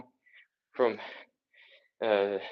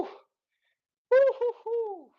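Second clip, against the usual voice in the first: celebratory whoop; much slower than usual. Real, not acted. Coach cheering herself after the final rep, high whoop. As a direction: whoop it out like you just finished the set.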